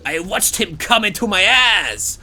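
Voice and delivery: loud, high, slowed comic character voice; Foxy. Retold or imitated. Streamer quoting a song's fox part, loud hammed-up voice. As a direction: belt it out slowly, hammed up. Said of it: "And then Foxy goes".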